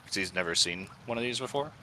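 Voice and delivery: usual speaking voice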